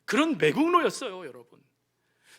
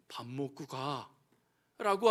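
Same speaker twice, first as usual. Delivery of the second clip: low, very slow and soft; tender invitation; 8 semitones lower, 7 dB quieter, much slower than usual. Acted. The Lord offering a meal, slow and tender. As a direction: invite them in kindly.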